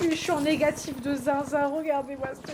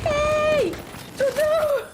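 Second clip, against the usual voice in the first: high shouted warning; high-pitched shout at the screen; high yell; 13 semitones higher, 7 dB louder, much slower than usual. Real, not acted. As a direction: yell at the monster to back off. Yelling at game enemy.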